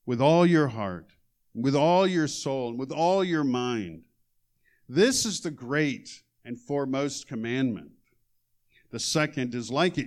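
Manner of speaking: loud, emphatic reading of the commandment; scripture reading. Acted. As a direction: proclaim the verses. Reads scripture aloud.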